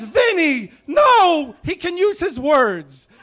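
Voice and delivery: high, loud, frantic mom; mother in the back seat begging her husband, high and loud; pleading mom. Retold or imitated. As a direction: squeal it like a panicked pacifist mother. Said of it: "And she says".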